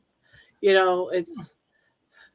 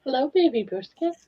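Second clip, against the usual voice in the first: pet talk; 5 semitones higher than usual. Real, not acted. Greeting the pet.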